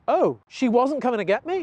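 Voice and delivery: dawning realization, rising into a question; Athena. Acted. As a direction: ask it as a puzzled dog catching on. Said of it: "and Athena goes".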